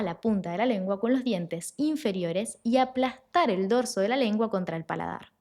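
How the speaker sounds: usual speaking voice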